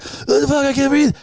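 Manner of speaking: high, strained cry that he can't breathe; choking panic. Retold or imitated. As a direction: play the pepper-burned guy gasping in panic. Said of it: "I'm like".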